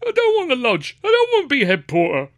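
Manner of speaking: very high, squeaky defiance; muffled high-pitched son's outburst; talking through a mouthful. A narrator's reading. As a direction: protest with cheeks full of sandwich. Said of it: "didn't help me to get rid of the mouthful of sandwich".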